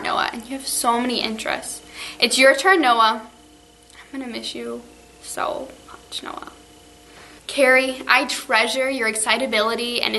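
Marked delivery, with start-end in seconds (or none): 3.9-6.5 whispering through tears; choked-up whisper; tearful goodbye. Real, not acted. Teacher's tearful farewell to students.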